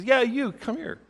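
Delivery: high, squawky-light parrot voice; parrot beckoning. Acted. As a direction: pitch it up like a parrot summoning someone. Parrot beckons man in joke.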